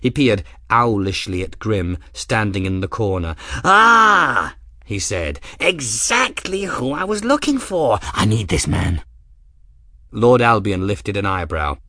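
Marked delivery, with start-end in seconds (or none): 3.6-4.5 put-on high, loud eager old man; excited old man. Eccentric old man Ferris. 5.6-9.0 put-on high, eager old man voice; eccentric old man.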